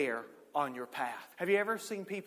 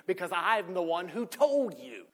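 indignant retort; 4 semitones higher, 6 dB louder than usual. Retold or imitated. Retelling angry reply in argument.

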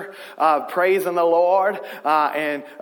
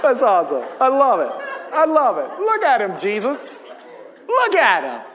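delighted; 4 semitones higher than usual. Retold or imitated. God delighting, excited.

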